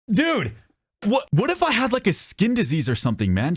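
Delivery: high-pitched comic outrage; indignant. Real, not acted. Storyteller protesting the cashier's rude question, indignant.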